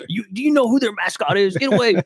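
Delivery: hammed-up horror, laughing through it; mock panicked mom. Father imitates mom warning son about rival school, laughing mock horror.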